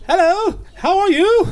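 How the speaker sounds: put-on high, plummy movie English accent; mock English accent. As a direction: greet in a hammed-up English accent. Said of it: "movies we've seen with the English accents".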